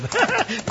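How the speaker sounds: high cackling laugh; old man's cackle. Acted. Rancher laughs as he greets his visitors. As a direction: cackle in relief.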